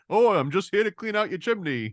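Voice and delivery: hammed-up cheerful sweep; lightly mocking a cheery chimney sweep line; mock chirpy chimney sweep.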